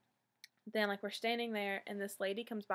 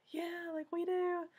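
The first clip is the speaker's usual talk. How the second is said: a much higher, eager "yeah, like, we do"; excited high yes; 9 semitones higher, slower than usual. Retold or imitated. Fans to the ticket guy, high and excited. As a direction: squeal it like you can't believe it.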